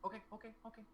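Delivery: put-on quick little agreeing voice of a child; eager little girl. Little girl told to keep eyes shut, eagerly obedient.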